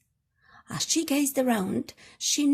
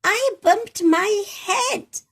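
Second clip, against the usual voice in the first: high, slow, louder sulky repeat; cross little voice; 9 semitones higher, 6 dB louder, slower than usual. Acted. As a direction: stretch it out, crosser the second time. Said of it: "answered the cross voice again".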